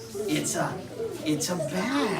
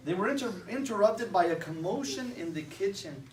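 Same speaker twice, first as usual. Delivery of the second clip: ordinary delivery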